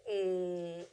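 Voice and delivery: ordinary talk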